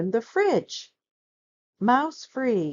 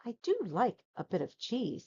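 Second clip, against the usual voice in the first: wistful mouse; 3 semitones higher, 7 dB quieter than usual. A narrator's reading. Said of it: "said the mouse".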